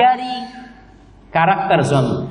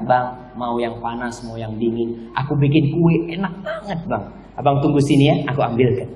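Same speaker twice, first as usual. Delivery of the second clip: lower, coaxing wifely voice; gentle attentive wife; 7 semitones lower, 4 dB quieter than usual. Acted. Wife offering husband drinks and cake, gently attentive.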